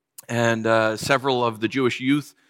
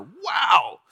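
excited; 10 semitones higher, much slower than usual. Retold or imitated. Excited about free burgers.

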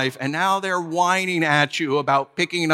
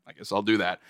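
put-on grudging kid; 6 semitones lower than usual. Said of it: "I'm like".

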